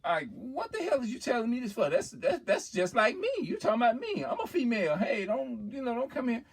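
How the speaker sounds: high-pitched imitation of a woman objecting; offended woman. Woman telling a man not to bring that talk to her, acted indignant. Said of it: "private things that a female do not want a male".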